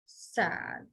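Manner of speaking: very low, soft drooping word; acted sad. Shown as a demonstration. Teacher modelling the sign for sad, acted sad. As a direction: let it sink, sad. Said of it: "more effective with that".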